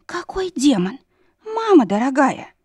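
high, breathless amazement; amazed babble. Acted. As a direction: gasp it out, stunned. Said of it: "пролепетала Дженни".

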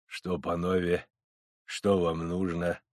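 low, slow, quiet question; understated old man, unhurried and low; aged wise Cossack. Acted. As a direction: ask softly, as if you know nothing. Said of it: "престарелый, но умный козак".

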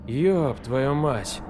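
slow, dazed, half-mad curse; unhinged exclamation. A narrator's reading. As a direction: let a hint of madness slip in. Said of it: "В его голосе слышались признаки безумия".

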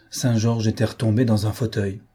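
ordinary delivery

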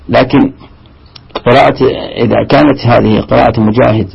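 ordinary delivery

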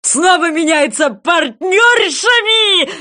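high, loud, drawn-out squeal; squealing shout. A narrator's reading. Sea wolf squeals the dance step, frenzied. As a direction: squeal it, stretching the last word. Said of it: "заверещал морской волк".

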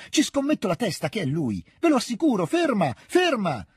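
high, excited cry building in volume; insistent shouting. A narrator's reading. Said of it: "gridò al cocchiere". (grido concitato e insistente)

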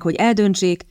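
usual speaking voice

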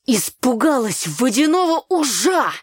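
loud, high, drawn-out yell; scornful shout. A narrator's reading. Brother mocking his sister's fright, shouting. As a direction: shout it in disbelief at her silliness. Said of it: "закричал Митька".

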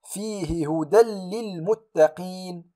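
هدى للمتقين recited slowly, tanween merged into doubled lam; slow Quranic recitation, hudal-lil; tajweed recitation. Shown as a demonstration. Teacher reciting the example, slow merged tanween. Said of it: "أقول".

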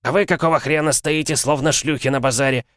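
angry yell. Acted. Merchant yells at his soldiers.

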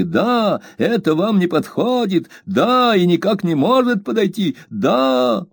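high, insistent repeated yes; high, insistent stubborn maid; emphatic Peggotty. A narrator's reading. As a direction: hammer each да home. Peggotty voiced in story.

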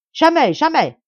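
high, very loud refusal; defiant roar. A narrator's reading. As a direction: hurl it back at them.

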